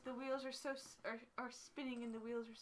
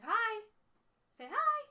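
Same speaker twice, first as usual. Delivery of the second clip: excited coaxing; 12 semitones higher, 13 dB louder, much slower than usual. Real, not acted. Coaxing toddler to wave hello.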